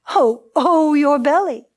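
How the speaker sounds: storyteller echoing the agony at full voice; echoed groan. A narrator's reading. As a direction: groan back in sympathy. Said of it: "They groaned".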